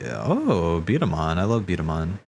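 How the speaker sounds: normal talking voice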